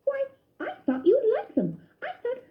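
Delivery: a cousin alarmed at the choking; gently worried voice; Ced concerned. A narrator's reading. Ced puzzled.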